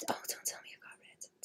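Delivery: a fast horrified whisper to herself; dismayed whisper. Real, not acted. Whispering to chat in dismay over lost art. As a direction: breathe it out, barely voiced, quick. Realising old art was deleted.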